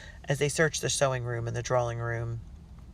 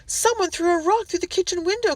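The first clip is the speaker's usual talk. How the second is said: high, loud, upset cook; alarmed cry; 6 dB louder than usual. A narrator's reading. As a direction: cry out in fright. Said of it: "Cook cried".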